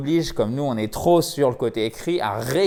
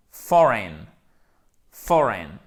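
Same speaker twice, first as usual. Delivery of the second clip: FOREIGN as loud FOR, weak en; first-syllable stress; 2 semitones lower, 7 dB louder, much slower than usual. Shown as a demonstration. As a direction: punch the start of "Foreign", let it fall. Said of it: "l'accent tonique en premier".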